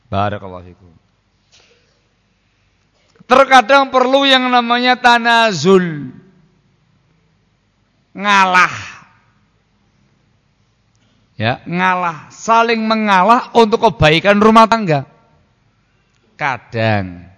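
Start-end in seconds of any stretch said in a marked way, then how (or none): 3.3-15.1 high, loud sermon voice; preaching. Acted. Preacher urging couples to give way, loud and high. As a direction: preach it out with force.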